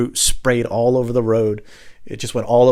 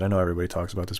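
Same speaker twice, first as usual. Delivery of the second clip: normal talking voice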